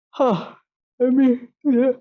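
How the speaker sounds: words stretched by a yawn. Real, not acted. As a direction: talk through a yawn. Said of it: "I'm yawning here".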